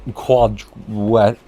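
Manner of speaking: ordinary delivery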